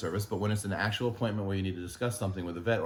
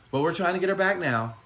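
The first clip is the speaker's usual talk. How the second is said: much higher and louder, sing-song mock of a chipper caller; mocking the doctor's voice; 10 semitones higher, 6 dB louder than usual. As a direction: parody her bright phone manner, pitched way up. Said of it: "this is how she sounded".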